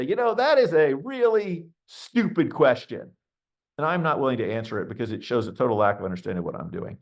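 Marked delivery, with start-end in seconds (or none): 0.0-3.1 slow, higher, deliberate mock-blunt retort; blunt put-down. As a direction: spell out the insult slowly to the VC. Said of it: "I wanna say".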